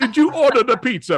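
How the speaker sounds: hammy fake Jamaican accent, laughing; goofy Jamaican accent; bad Jamaican accent. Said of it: "a bad Jamaican accent".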